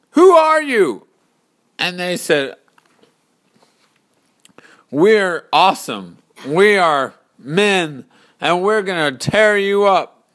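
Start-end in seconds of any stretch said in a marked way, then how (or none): 0.1-1.1 high, loud challenge; shouted demand. Acted. Hero shouts at unseen army. 4.9-10.2 loud, raised, menacing boast; boastful warriors. Acted. Army boasts and threatens.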